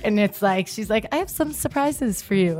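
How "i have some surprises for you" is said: imitated accent, pitched well up; higher-voiced imitation of a singer's accent; [name]'s accent. Co-host quoting the singer at her festival show, imitating her accent. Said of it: "in her accent".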